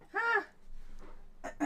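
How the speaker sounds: squeaky high startled huh; mock huh. Real, not acted. Reacting to a viewer asking about family, mock huh. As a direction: jump up in pitch, half-joking.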